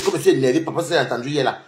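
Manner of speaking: normal talking voice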